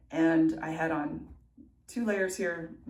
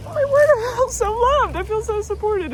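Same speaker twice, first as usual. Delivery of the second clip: much higher, gushing with happiness; overwhelmed joy; 15 dB louder than usual. Retold or imitated. Runner gushing to viewers about feeling loved by her running group. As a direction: squeal it like you're overwhelmed by love. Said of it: "I just felt really like".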